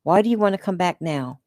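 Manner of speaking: usual speaking voice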